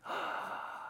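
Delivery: startled gasp. Retold or imitated. Mary recognising Jesus.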